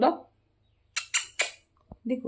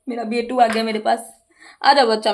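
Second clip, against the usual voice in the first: normal talking voice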